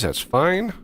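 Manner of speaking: ordinary delivery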